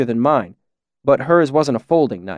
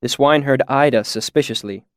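ordinary delivery, nothing marked

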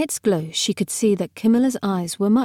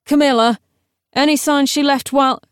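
raised, higher calling voice; 6 semitones higher, 4 dB louder, faster than usual. A narrator's reading. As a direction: call up to a companion out of sight. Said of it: "The Necro called out".

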